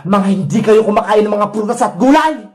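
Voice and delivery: low, scolding villain voice; acted anger from the wicked vendor; angry villain. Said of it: "Galit na galit ako sa inyo". (galit na mababang boses ng masamang tindera)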